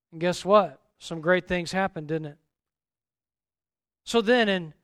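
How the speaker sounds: ordinary delivery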